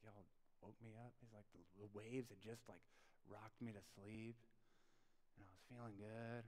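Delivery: low, very soft mumbled complaint; sleepy grumble. Acted. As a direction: grumble quietly like someone woken from a nap. Jesus grumbling, going back to sleep.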